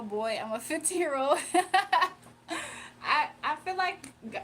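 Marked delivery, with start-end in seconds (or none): 1.4-3.0 high laughing burst. Real, not acted. Streamer laughing at a fan's Sigma question, high laugh.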